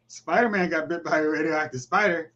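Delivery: normal talking voice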